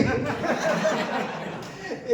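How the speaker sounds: high, long real laugh; a real laugh at the scam; burst of laughter. Real, not acted. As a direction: crack up laughing. Laughs at the scammer calling back.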